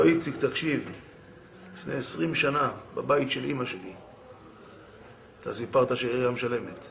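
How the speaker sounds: low, softened, measured confession; calm sincere reveal. Retold or imitated. Supplier quietly reveals long gratitude.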